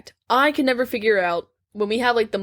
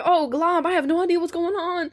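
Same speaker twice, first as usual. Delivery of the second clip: retold bewilderment; 8 semitones higher than usual. Retells own confused reaction.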